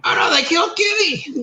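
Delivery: high crying shout of mock grief; mock anguished cry. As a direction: wail it like a cartoon kid in despair. Quoting South Park line.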